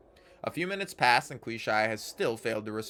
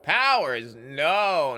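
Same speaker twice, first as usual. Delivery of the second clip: exasperated shout; 3 semitones higher, 7 dB louder, much slower than usual. Acted. Mort mocks the statue's speech.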